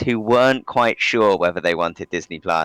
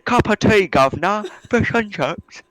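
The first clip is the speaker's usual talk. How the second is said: much higher, hammed-up British accent; mock British accent; 12 semitones higher than usual. Friend mocking Cage's fake British accent, hammed-up. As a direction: overdo the silly British accent like Nicolas Cage. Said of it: "puts on a British accent".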